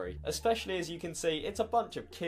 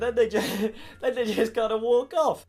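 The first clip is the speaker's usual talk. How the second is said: louder, higher, words breaking into laughter; laughing; 7 semitones higher, 8 dB louder than usual. Real, not acted. Teller laughs as they walk off, laughing. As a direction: crack up while telling it.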